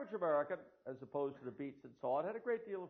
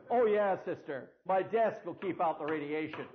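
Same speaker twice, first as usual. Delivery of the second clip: sarcastic; 3 semitones higher, 10 dB louder, slower than usual. Retold or imitated. Mocking reply to the nun.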